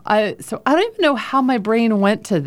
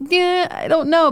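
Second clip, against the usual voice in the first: skeptical; 10 semitones higher than usual. Retold or imitated. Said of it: "and I'm like".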